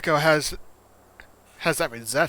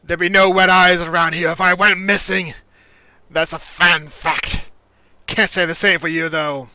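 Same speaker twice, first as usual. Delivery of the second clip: loud, higher-pitched game character; Echo; 7 semitones higher, 14 dB louder than usual. Acted. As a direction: push it out loud and high, full of bluster.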